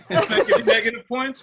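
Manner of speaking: loud laughing burst through the words; laughing loudly. Real, not acted. Judge cracking up at negative points, laughing loudly.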